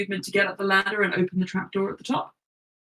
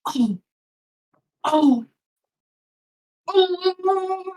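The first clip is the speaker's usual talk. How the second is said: Kev panicking; 8 semitones higher, much slower than usual. Acted. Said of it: "and goes".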